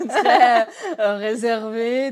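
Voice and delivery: en riant, plus fort; laughing. Real, not acted. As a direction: rire en parlant. (en riant, plus fort)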